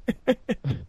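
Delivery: high, short giggle; laughter. Real, not acted. Host laughing at the awkward pause, high giggle.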